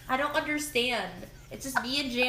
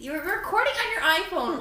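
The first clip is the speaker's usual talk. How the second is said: laughing; 7 semitones higher, 4 dB louder than usual. Real, not acted. Laughing disbelief.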